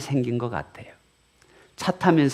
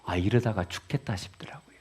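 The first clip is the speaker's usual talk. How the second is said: whisper; 3 semitones lower, 8 dB quieter than usual. Retold or imitated. Retelling fear during surgery.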